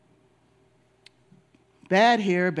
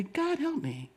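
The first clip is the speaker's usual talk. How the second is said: "God help me" breathed very softly, almost a whisper; soft surrendered prayer; 3 semitones higher, 11 dB quieter, slower than usual. Shown as a demonstration. Pastor teaching the congregation a hushed, gentle prayer.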